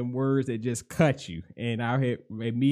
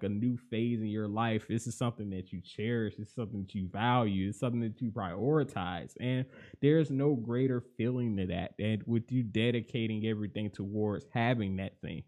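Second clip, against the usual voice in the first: usual speaking voice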